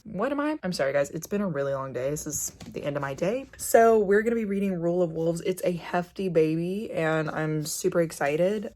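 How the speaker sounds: normal talking voice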